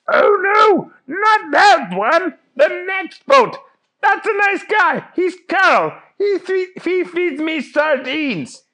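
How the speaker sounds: very high, squealing cartoon fish; Fish Guy protesting. Fish Guy defends his friend Carl, high-pitched wail. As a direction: cry out in a high, frantic put-on voice.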